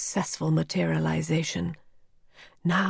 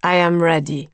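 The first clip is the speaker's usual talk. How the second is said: louder, odd, tense tone; eerie readiness; strange voice; 2 semitones lower, 4 dB louder than usual. A narrator's reading. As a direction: say it in an uncanny voice. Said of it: "in an indescribable voice".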